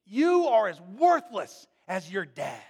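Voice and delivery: loud, slow bitter yell; yelling mother. Retold or imitated. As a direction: spit it at him like a drunk mom. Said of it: "She's yelling".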